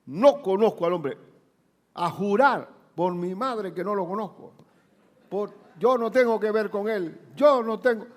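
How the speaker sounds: high, loud cursing oath; swearing denial. Acted. Peter cursing and swearing he doesn't know Jesus. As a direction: swear it out hot, denying him. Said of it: "comenzó a maldecir y a jurar".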